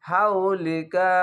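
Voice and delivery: হাউলিকা read slowly in one unbroken flow; joined reading. Shown as a demonstration. Teacher shows reading হাউলিকা joined. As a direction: connect it without stopping. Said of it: "আর যদি না থামেন তাহলে".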